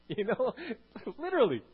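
laughing loudly through words. Real, not acted. Laughs at himself.

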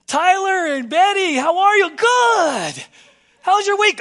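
loud, high, beaming welcome at the door; cheerful greeter. Retold or imitated. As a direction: greet them like long-lost friends.

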